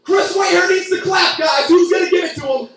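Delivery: loud, high shouts to the staff; shouting across the kitchen; shouting for help. Retold or imitated. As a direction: yell for the clappers from the back. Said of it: "I gotta go in the back".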